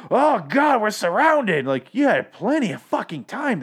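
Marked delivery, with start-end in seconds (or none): 0.1-1.7 high, loud panicked soldier cry; a frantic squeal of mock alarm. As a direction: ham up the terror of being surrounded. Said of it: "Why are they suddenly like".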